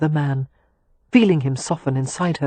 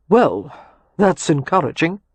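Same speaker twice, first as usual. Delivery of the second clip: dry irony; 4 dB louder than usual. A narrator's reading. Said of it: "his tone conveyed the opposite".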